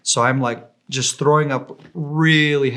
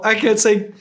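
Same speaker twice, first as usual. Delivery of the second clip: normal talking voice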